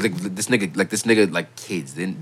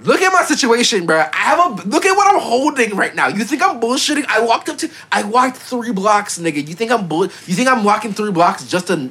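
heated threat; 7 semitones higher than usual. Retold or imitated. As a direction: confront him hard over the money. Retelling heated confrontation over money.

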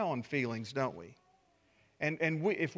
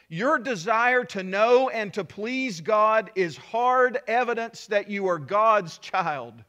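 louder, slow, each word weighed; slow emphatic preaching; 4 semitones higher, 7 dB louder, slower than usual. Acted. Pastor assures anxious believers they are God's child, slow and loud. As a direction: land it hard, slowly. Preacher drives point home.

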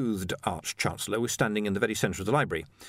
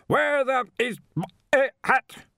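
high, loud, broken-up roar; bellow; 5 dB louder than usual. A narrator's reading. Arch-Chancellor demands his hat, bellowing. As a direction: bellow each word in outrage. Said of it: "he bellowed, his voice bouncing off".

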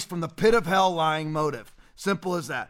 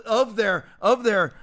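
ordinary talk

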